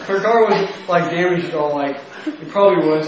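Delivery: high and loud, giggling through it; recovering from a laughing fit; still laughing. Real, not acted. Recounting rear-ending the lady, still giggling loudly.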